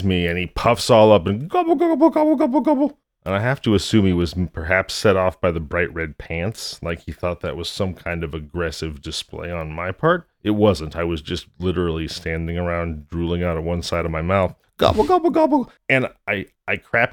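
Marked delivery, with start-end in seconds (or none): 1.5-3.0 high squeaky gobbles; gobbling turkey voice. Retold or imitated. Turkey gobbling mid story. 14.8-15.7 high squeaky gobbles; gobbling turkey voice. Retold or imitated. Turkey gobbling mid story.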